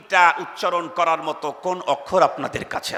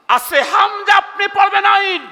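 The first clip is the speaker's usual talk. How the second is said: loud and much higher, পড়বেন আইন hurled at them; shouted rebuke; indignant shout; 11 semitones higher, 5 dB louder than usual. Real, not acted. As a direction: shout the mistake at the crowd. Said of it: "আপনি পড়বেন হামজা".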